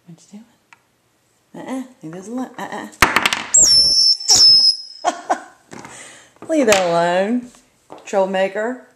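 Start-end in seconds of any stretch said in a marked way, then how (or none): 2.9-6.4 high burst of laughter; loud squealing laugh. Real, not acted. Bird plays, she laughs. 6.5-8.9 playful scolding. Real, not acted.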